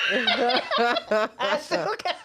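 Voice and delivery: high, slow words through laughter; laughing. Real, not acted. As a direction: struggle to speak while cracking up. Cracks up describing the hug.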